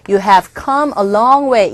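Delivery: "long" stretched and falling; slow model with "long" drawn out; stress on long. Shown as a demonstration. Teacher modelling "You have come a long way" with "long" held. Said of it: "시제 일치시켜주기 위해서".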